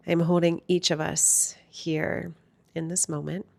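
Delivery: hushed, soothing lead-in to stillness; guided meditation. Real, not acted. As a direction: gently guide listeners inward, unhurried and calm.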